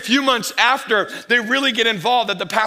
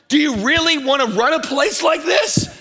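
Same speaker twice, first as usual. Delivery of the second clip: exasperated outburst; 6 semitones higher than usual. Retold or imitated. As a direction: throw the question at the company. Ranting at a bad company.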